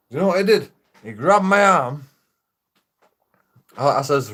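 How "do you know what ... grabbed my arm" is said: higher, louder indignant outburst. Real, not acted. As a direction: tell it outraged. Indignant climax of story.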